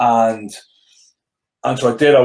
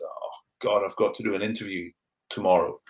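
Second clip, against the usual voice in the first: softer, sinking inner voice; quiet dread; 4 semitones lower, 9 dB quieter than usual. Retold or imitated. Player recalling his interview nerves, quiet dread. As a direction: voice the worry to yourself, much softer. Retold anxious inner thought.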